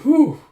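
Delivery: high loud giddy exhale; giddy whew. Retold or imitated. Villain relaxing after sending letter, giddy whew. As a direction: sigh out with glee. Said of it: "laughing giddily".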